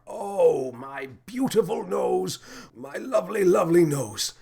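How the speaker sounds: high, wailing, self-pitying captain; a pompous officer lamenting his broken nose, overwrought; melodramatic captain. A narrator's reading. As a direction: ham up the outrage over his nose.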